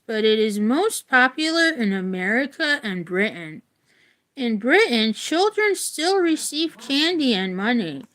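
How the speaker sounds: clear, measured book-reading voice; reading aloud. Acted. Reading a Valentine's history book to viewers, in an even read-aloud voice. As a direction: read it out like a class textbook.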